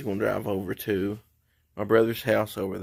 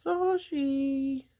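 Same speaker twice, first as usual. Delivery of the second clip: much higher sing-song dog call. Real, not acted. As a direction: coo the dog's name up high. Calling a dog on porch.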